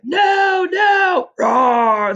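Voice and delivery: loud high roaring cry; monster bellow. Acted. Monster bellows from inside, loud roar. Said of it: "the monster bellowed".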